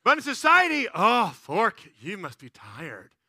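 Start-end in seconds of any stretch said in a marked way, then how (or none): 1.0-3.1 mock pity. Stranger pitying a parent of four, mock concern. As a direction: imitate slow, drooping condolence.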